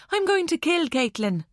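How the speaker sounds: lightly said, high and breezy as small talk; casual, matter-of-fact. Acted. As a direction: toss off the threat like chatting about the weather. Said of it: "like she was talking about the weather".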